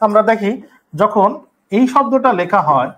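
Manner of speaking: ordinary delivery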